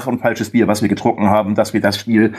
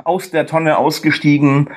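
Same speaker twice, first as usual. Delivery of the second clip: usual speaking voice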